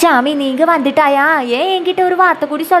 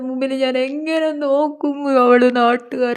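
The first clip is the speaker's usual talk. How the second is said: wailing. Acted. Mother lamenting her son's sudden marriage, wailing. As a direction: wail to heaven in theatrical grief. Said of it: "anti, ഇങ്ങനെ കരയല്ലേ".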